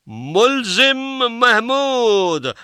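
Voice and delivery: loud, high, drawn-out courtroom summons; court constable calling out. Acted. Constable summons accused Mahmood to the film court, stretched high call-out. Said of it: "हवलदार ने आवाज दी".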